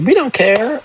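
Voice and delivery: high, careless mock voice of people who don't care; dismissive community voice. Cop mimics an uncaring community, high and dismissive. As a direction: toss it off, mocking indifference. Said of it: "a community that's just like".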